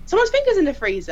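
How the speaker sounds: high, fast startled echo; alarmed repeat. Retold or imitated. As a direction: blurt it out in shock. Scene guard alarmed by remark.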